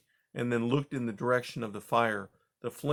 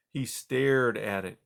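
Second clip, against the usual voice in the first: plain reading voice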